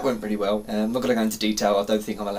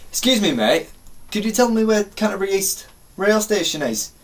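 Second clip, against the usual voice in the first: higher matey enquiry; put-on matey character; 6 semitones higher than usual. Asking a bloke for the station, put-on matey voice. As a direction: play a character asking for help. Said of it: "I like being different characters".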